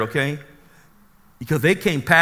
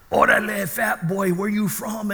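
higher-pitched homeboy call; mock gang taunt; 7 semitones higher than usual. Gang calling to the driver from across the street, mock taunt. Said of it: "they started cursing me from across the street".

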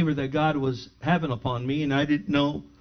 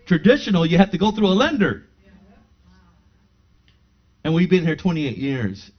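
rising preaching; 5 semitones higher, 6 dB louder than usual. Real, not acted. Preaching climax of the testimony.

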